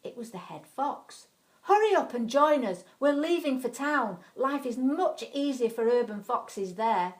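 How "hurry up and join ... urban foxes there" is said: louder, raised commanding call; loud urging. Acted. Head fox urging the foxes to leave, loud command. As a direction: hurry them along loudly. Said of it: "commanded a loud voice".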